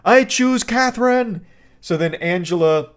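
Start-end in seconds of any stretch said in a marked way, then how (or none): 0.0-1.4 whiny dad. Retold or imitated. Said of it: "the whiny dad of Catherine, like, says".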